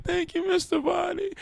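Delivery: very high, loud imitation of a crying child; mock crying kid. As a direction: wail it like a kid in tears, hammed-up. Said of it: "the kid starts crying".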